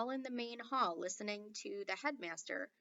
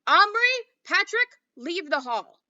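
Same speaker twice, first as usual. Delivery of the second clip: much higher, very loud order; furious headmaster; 9 semitones higher, 16 dB louder than usual. A narrator's reading. Headmaster ordering the boys out, high and very loud. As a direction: throw them out.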